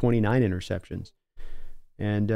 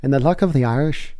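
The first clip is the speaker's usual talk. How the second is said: reading a chat message in a higher, louder put-on Irish accent; hammed-up Irish lilt, a bit slower on "me thinks"; 4 semitones higher, 5 dB louder than usual. Streamer voicing a viewer's chat comment, in a playful Irish accent. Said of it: "if I just did the Irish accent".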